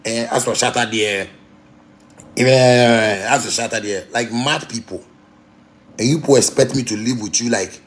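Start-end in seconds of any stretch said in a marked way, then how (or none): 0.0-4.1 lightly voiced grumbler with a drawn-out "eh"; mock a critic talking behind someone's back; imitating gossiping critic. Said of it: "then somebody go talk".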